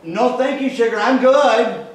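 high, loud honking mock reply; honky voice. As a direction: honk it back up the stairs at your wife, slow on sugar. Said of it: "in my honky voice".

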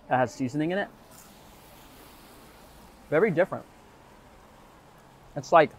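eating fries while speaking; chewing while talking. Real, not acted. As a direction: mouth partly full. Seen 0: Eats fries before; mouth seems chewing through about +3.4, fry in hand.

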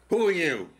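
guard's hostile challenge, loud and high; gruff demand. Retold or imitated. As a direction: bark it like a suspicious prison guard. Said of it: "In that tone?".

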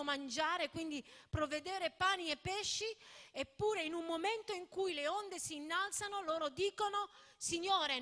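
fervent sermon delivery, pleading; preaching. Acted. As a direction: preach it to the congregation with force. Preaching with force over music.

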